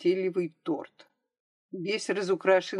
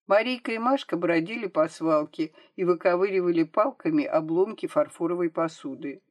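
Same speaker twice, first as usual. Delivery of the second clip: ordinary delivery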